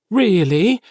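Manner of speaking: high, loud burst of eager disbelief; blurted out. A narrator's reading. Felix is invited to tea by Arlo, blurting it out. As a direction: let it burst out before you can stop it. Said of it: "he blurts out".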